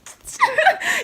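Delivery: high, giddy squeal; giddy squeal laugh. Real, not acted. Fan picked to go on stage, squealing laugh. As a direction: squeal with joy.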